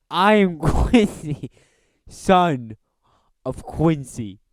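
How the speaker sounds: high, loud, slow heroic declaration; grand Quincy proclamation. Pretend. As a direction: declaim the motto like an epic hero.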